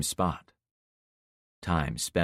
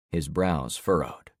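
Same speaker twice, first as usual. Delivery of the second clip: plain reading voice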